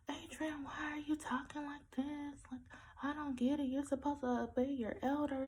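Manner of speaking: high-pitched mock mom voice; imitated scolding mom. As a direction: mimic a mother's complaint, pitched high. Retells mother's scolding.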